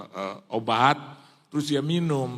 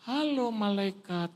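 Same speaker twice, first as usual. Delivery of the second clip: high, slow drawn-out hello; mock motherly greeting; imitated doting mother; 8 semitones higher, slower than usual. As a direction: greet lingeringly in a raised voice.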